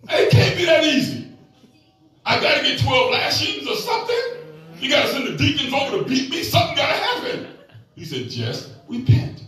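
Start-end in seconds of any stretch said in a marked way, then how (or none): 0.1-7.5 high-pitched mock pleading; disbelieving protest. Son begging his bishop dad for punishment, mock desperate. 8.3-9.4 slow, low, measured advice; calm father. Retold or imitated. Dad answering his frantic son, calm and slow.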